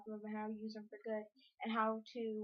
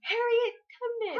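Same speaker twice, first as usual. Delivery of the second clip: disbelieving exclaim; 12 semitones higher, 8 dB louder than usual. Real, not acted.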